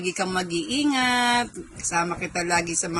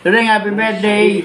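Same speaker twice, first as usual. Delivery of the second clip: loud greeting; 10 dB louder than usual. Real, not acted.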